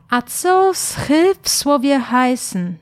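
ordinary talk

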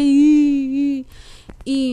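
imitating her own loud bawling; hammed-up sobbing cry. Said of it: "empecé a berrear".

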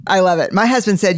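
usual speaking voice

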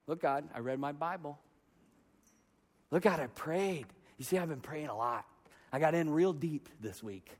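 low, soft aside to God; hushed self-justifying prayer. Acted. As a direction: quietly list your merits to God. Acted bargaining prayer to God.

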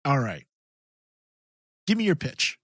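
usual speaking voice

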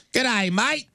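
high-pitched, mocking Australian accent. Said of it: "You're telling me that's not sexier".

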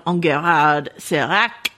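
hammed-up French accent on the name; terrible French accent. Host introducing a character's backstory, terrible French accent. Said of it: "my terrible French accent".

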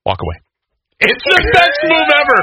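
normal talking voice

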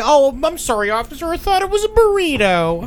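high, sheepish fake apology; mock innocent excuse.

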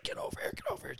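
sharp backstage whisper, barely voiced; urgent whisper. Headset lady urgently summoning the host, whispered. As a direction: hiss it urgently from the wings. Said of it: "this lady in a headset's like".

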